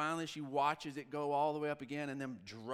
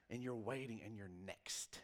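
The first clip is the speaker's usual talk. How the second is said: whisper; 5 semitones lower, 6 dB quieter than usual. Retold or imitated. Tense wait before the ride.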